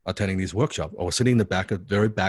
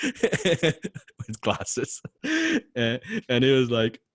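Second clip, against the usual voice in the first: ordinary talk, nothing marked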